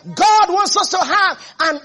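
high, loud shouted sermon; fiery preaching. Acted. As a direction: preach it out to the whole house, full voice. Preaching at full force.

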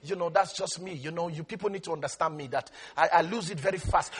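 low, soft mock confession; excuse-making church member. Church member excusing a quick temper to the pastor, low and soft. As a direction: murmur a lame excuse to the pastor. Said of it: "I say, "You know, Pastor".

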